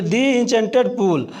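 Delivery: "The" said "dee" before Enchanted. Shown as a demonstration. Teacher showing students "The" before a vowel, said "dee". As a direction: say "The" as "dee", with a long ee. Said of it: "इसे दी पढ़ते हैं".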